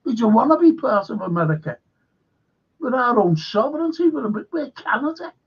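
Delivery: high, fast mocking whine; mock Canadians. Mocking the Canadians refusing to join America, high and fast. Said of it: "the Canadians went".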